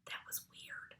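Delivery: hushed unvoiced aside; whispered aside. A narrator's reading. Narrator's private comment on the tarantula, a hushed aside. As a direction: mutter it to the listener.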